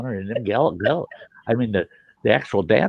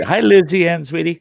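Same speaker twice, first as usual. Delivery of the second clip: higher, louder sweet hello; a cheery, affectionate hello to Lizzie Ann; sweet greeting; 8 semitones higher, 6 dB louder than usual. Real, not acted. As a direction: greet her fondly. Greeting a listener.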